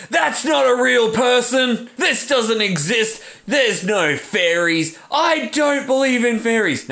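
high, slow heckler's shout. Retold or imitated. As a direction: yell it out to ruin the play. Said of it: "bust into a Peter Pan play and went".

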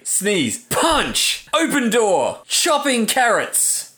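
high, punchy sound-effect calls; announced action words. Pretend. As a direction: call it out like an onomatopoeia. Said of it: "kind of like the onomatopoeia of".

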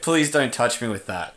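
ordinary talk, nothing marked

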